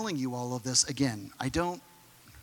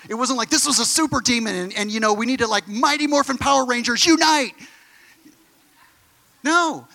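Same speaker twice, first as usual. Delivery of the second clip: much higher and louder, driving hard; fiery preaching; 9 semitones higher, 6 dB louder than usual. Real, not acted. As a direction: preach it at full force. Preaching at full force, mocking disciples.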